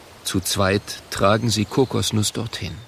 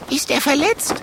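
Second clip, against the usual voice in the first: excited. A narrator's reading. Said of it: "fragt der Zwergdrache aufgeregt".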